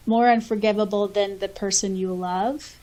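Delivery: ordinary delivery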